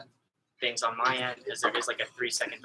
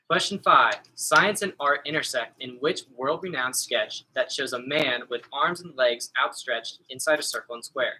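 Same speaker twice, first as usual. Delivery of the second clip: louder, announcing quiz-host read; 6 dB louder than usual. Acted. Trivia host announcing the question to the teams, projected and clear.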